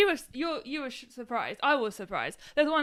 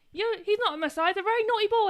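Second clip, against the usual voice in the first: Monty Python quote; 9 semitones higher, 5 dB louder than usual. Retold or imitated. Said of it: "where he goes".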